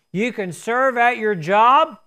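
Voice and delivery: ordinary talk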